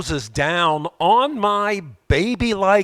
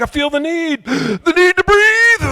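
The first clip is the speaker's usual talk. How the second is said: Top Gun pilot gasping; 14 semitones higher, 7 dB louder than usual. Acted. Top Gun line in a sleep mask, gasping and loud. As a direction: gasp the line like a choking pilot. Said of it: "It's like".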